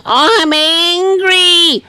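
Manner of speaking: very high, long sustained mock holler; high wail held on and on, without real force; mock yelling. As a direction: stretch it out like yelling from a balcony. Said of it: "kept yelling".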